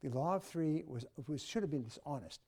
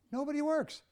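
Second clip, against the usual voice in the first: loud, high-pitched exclamation; astonished outburst; 12 semitones higher, 7 dB louder than usual. Real, not acted. Speaker tells the audience what offices are like, astonished outburst. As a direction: burst out in disbelief, far above your usual pitch. Exasperated outburst after duck imitation.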